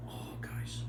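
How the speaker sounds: breathless whisper of delight; hushed, savouring the taste. Real, not acted.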